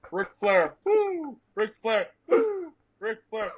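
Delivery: high wrestling-promo whoops; Ric Flair woo. Retold or imitated. Describing the smelling salts rush, high wrestler whoops. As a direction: hype it like a wrestler yelling woo. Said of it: "it was like".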